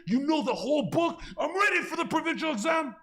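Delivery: very high, fired-up shout; shouted example. Retold or imitated. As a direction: act out an over-eager student yelling. Shouted example, acted student.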